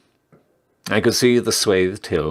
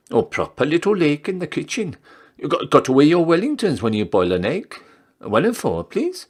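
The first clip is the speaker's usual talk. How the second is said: chatty barmaid; 9 semitones higher than usual. Acted. Reader voices gossipy barmaid.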